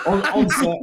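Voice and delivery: words broken by laughter; laughing while telling. Real, not acted.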